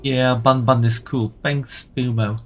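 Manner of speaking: ordinary delivery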